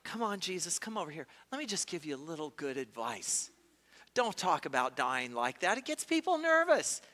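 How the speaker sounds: a hint of a sly friend taking him aside; lightly voiced Peter murmuring advice; mock smooth-talking Peter. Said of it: "Peter isn't, you know".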